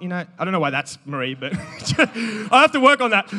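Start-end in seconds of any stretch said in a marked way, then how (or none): 1.5-3.3 slow line broken by a laugh. Real, not acted. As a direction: laugh at yourself. Laughs at own impression.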